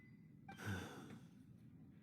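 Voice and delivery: long tired breathy sigh; weary sigh. Acted. Weary old drunk sighs.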